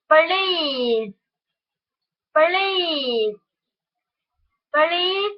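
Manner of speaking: please with a long ee vowel; vowel drill please. Shown as a demonstration. Teacher drills the vowel in please. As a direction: stretch the ee in please. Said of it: "the monophthong".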